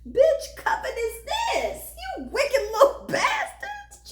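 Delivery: very high, slow, shrieking laugh through the insult; laughing mock outrage. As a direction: cackle it out at the witches. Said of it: "I said".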